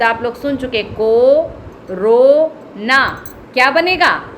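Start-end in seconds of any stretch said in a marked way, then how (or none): 0.9-3.0 very slow stretched reading; slow teacher read of corona; slow demo word. Shown as a demonstration. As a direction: drag out each syllable. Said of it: "आप सब पढ़ लोगे".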